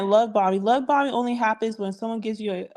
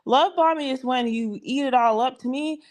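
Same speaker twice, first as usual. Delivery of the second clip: ordinary delivery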